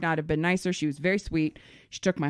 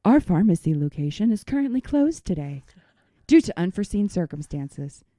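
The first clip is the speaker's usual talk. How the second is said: louder recorded-system announcement; automated message; 7 dB louder than usual. Retold or imitated. Mimicking the pharmacy's automated phone line, flat announcer read. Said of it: "the automated system says".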